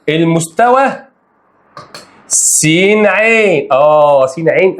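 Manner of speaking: slow drawn-out stress on "س عين"; emphatic reading. Shown as a demonstration. Teacher insisting on the plane name, drawn-out. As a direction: lean on "س عين", stretching it. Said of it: "ركز فيها".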